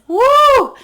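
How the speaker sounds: high, loud whoop of excitement; real thrilled whoop; excited woo. Real, not acted. Goosebumps from the reading.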